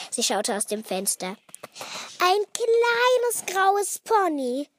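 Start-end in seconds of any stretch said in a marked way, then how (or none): 2.2-4.7 high, loud, drawn-out exclamation; amazed delight. A narrator's reading. Girl spotting her pony outside, amazed delight. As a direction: draw it out in thrilled wonder. Luisa sees her dream present.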